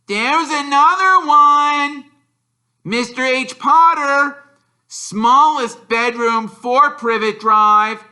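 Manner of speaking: high, loud, slow taunting call; a boy yelling down the hall; shouted. A narrator's reading. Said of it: "Then he shouted".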